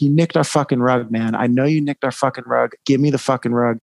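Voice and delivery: usual speaking voice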